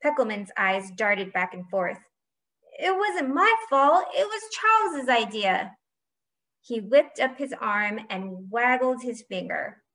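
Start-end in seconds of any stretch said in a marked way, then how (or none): 2.8-5.8 high, protesting whine; high panicky excuse; whiny blame-shifting. A narrator's reading. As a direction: squeal on your teammate to save yourself. Caught cheater blames his teammate.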